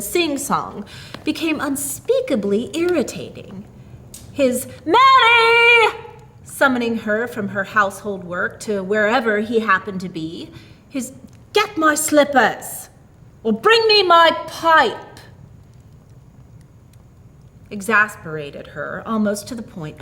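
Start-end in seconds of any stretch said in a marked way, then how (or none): 4.9-6.0 high, loud summoning yell of her name; a raucous shout of the wife's name; bellowing husband. A narrator's reading. Husband summoning his wife. 11.5-12.9 slow, a touch higher demanding order; a hint of the domineering husband; mildly gruff command voiced in narration; lightly voiced bossy husband. A narrator's reading. 13.5-15.2 slow, higher, louder order; husband's loud bossy order; demanding shout. A narrator's reading.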